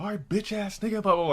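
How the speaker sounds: high-pitched mock comeback; barking back, retold. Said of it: "I had to bark back".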